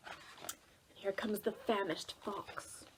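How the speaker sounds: plain reading voice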